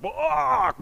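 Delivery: loud, high attacking cry; mock battle yell. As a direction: charge in with a yell. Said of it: "he was just like".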